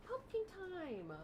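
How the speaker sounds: high, slow breathless mock wonder; mock gasp of delight. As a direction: imitate her awe at pumpkin season. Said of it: "She's like, gasps".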